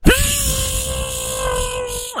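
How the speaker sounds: long, high buzzing noise; sustained electrical sizzle; imitated electric zap. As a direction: buzz like current running through someone.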